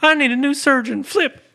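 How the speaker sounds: very high, squeaky complaining caller; fake customer voice. [name] pretends to be a customer in the fake commercial, very high and squeaky. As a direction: play a whiny ad customer. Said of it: "pretend that I was not me in the commercial".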